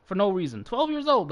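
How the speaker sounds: ordinary talk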